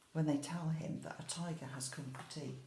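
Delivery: plain reading voice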